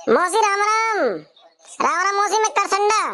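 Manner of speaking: high-pitched hollered greeting; calling out. Acted. Karsanda greeting auntie from outside, calling out. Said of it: "सुबह सुबह आके कौन चिल्ला रहा है".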